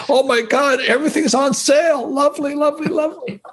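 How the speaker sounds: high, gloating delight, fading on the last lovely; gleeful delight. Retold or imitated. To the listeners, gloating over a crash sale, high and delighted. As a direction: savor the crash like a bargain sale.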